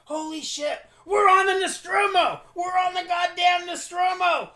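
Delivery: ecstatic yelling, high and loud; excited shout. Acted. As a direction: shout it with wild joy. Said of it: "He is enthused".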